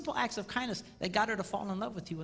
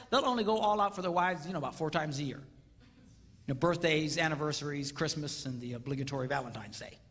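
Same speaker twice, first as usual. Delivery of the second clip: ordinary talk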